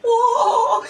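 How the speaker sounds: high starstruck whoa; a swooning high whoa. Real, not acted. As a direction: gasp out a dazzled whoa. Seeing Bobby Doll, starstruck.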